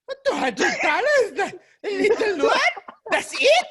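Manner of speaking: high, loud, incredulous mom; imitating mom's loud disbelief. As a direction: squawk it for the whole restaurant to hear. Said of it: "she was saying it so loud".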